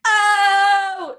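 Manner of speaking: high, drawn-out mock gasp of "oh"; loud scandalized "oh". Acting a viewer hearing the cutting remark, loud scandalized oh. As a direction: blurt a big shocked oh. Acted viewer reaction to the line.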